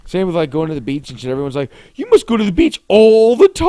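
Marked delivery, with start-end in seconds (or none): 2.0-3.7 high, loud mock tourist voice. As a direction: imitate the people who say it. Mocking what everyone says.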